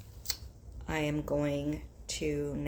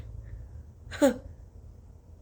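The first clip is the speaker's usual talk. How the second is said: breathy laugh; 9 semitones higher than usual. Real, not acted. Laughs after sneezing.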